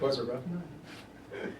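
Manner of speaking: slow, soft, dry delivery of "'Twas a rough night."; underplay it as a dry joke; deadpan understatement. Acted. Teacher mocks Macbeth's curt reply to the long storm speech, deadpan. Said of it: "kind of a funny line".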